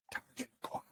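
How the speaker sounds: secretive whisper, barely there. Acted. Boy on a secret mission, whispered. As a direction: whisper it like a covert signal. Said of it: "Later, he also whispers".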